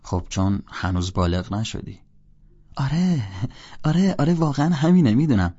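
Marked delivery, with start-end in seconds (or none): 2.8-5.5 loud, high burst of yeses; eager agreement. A narrator's reading. Holden admitting his friend is right, excited. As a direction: jump in excitedly, voice raised.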